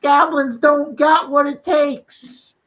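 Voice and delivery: high, slow, mocking taunt; thrown taunting voice. Bard throwing her voice to taunt the goblins, high and slow mockery. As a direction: jeer at the goblins from afar in a high, drawn-out voice. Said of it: "cup it so my voice goes kind of more towards this direction".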